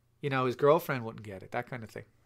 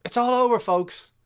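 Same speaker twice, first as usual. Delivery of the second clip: higher, louder hammy announcer sign-off; mock announcer; 8 semitones higher, 4 dB louder than usual. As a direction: call it like an over-the-top game announcer. Movie ending, hammy sign-off.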